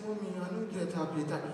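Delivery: put-on soft, faint sick child; weak child. Sick child answering mama, soft and weak. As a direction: whimper it softly.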